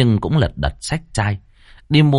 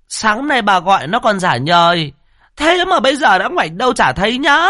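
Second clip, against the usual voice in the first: shrill cursing tirade; 10 semitones higher than usual. Acted. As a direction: scream curses across the yard like a furious village shrew. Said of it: "hướng sang bên ấy mà chửi".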